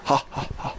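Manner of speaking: dry, spaced-out ha ha ha; fake laugh. [name] laughing at his own joke, fake laugh.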